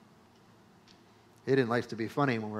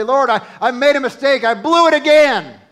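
loud, much higher repentant cry to God; pleading prayer; 10 semitones higher, 12 dB louder than usual. Pretend. Believer confessing a sin to the Lord, loud pleading prayer. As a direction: pour it out like a desperate confession. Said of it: "come boldly before him and say".

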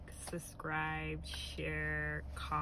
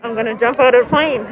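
loud, higher, over engine noise; shouting excited; 6 semitones higher, 23 dB louder, faster than usual. Real, not acted. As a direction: yell it over the roar. Shouting in plane before jump.

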